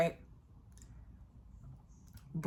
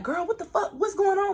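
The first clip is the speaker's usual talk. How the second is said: much higher, panicked friend's voice; much higher alarmed friend; 10 semitones higher than usual. Retold or imitated. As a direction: voice the frantic girlfriend. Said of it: "Shawty from Dallas come over to me like".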